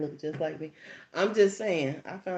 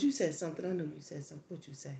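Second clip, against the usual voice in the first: low suspicious questioning; 3 semitones lower, 7 dB quieter, faster than usual. Retold or imitated. Mother suspicious interrogating.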